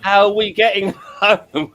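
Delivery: mock shout over the din; shouting over a crowd. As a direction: yell it to a friend across a noisy room. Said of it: "standing next to your friend going".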